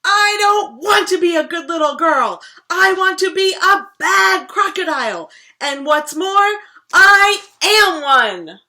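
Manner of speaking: loud, high furious shout, slowing at the end; yelled. Acted. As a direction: roar it defiantly. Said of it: "He yelled".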